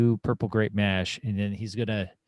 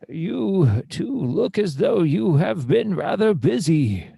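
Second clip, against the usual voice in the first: slow, louder, higher grand voice with swooping tune; theatrical adventurer; 6 semitones higher, 5 dB louder, slower than usual. Acted. Kane sizes up the two hunters, slow and grandly theatrical. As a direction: roll every word out pompously.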